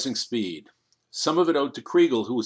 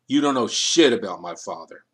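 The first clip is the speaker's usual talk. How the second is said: angry; 3 semitones lower, 5 dB louder than usual. Acted. Batiste snaps at Kriegel.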